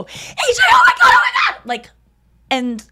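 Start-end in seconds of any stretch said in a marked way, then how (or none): 0.0-1.6 very high laughing shriek; mom's panicked scream. Retold or imitated. Mom shrieking on the phone, very high panicked scream. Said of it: "I hear my mom go".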